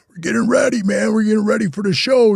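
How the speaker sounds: high, strained hype-up; pumped-up imitation. Imitating actors doing push-ups before the show, pumped up. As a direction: mimic actors psyching themselves up. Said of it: "they had to look all pumped up".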